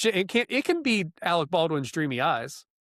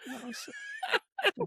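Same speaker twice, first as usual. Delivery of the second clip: laughing; 7 dB quieter, much slower than usual. Real, not acted. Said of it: "okay, that was it".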